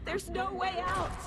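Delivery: mounting despair. Acted. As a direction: pitch it up, hopeless and trapped. Despairing, trapped in her mind.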